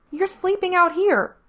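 put-on high, loud little girl voice; too loud. A narrator's reading. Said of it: "still too loud".

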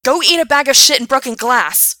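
high, loud furious yell; furious shout. Acted. Gerard shouting at Alex over the vodka, furious yell. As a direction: scream the insult at him. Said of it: "Gerard shouted".

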